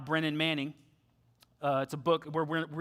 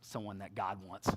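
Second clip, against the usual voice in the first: resigned; 9 semitones lower, 9 dB quieter than usual. Retold or imitated.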